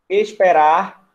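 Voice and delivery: "esperar" with a loud, held final "rar"; stress on last syllable. Shown as a demonstration. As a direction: lean hard on the end of "esperar". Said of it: "A última sílaba de esperar é essa aqui". ("esperar" com a última sílaba bem forte)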